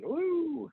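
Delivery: long, high-pitched "woo"; playful drawn-out coo of wonder; high swooping "woo"; mock wondering woo.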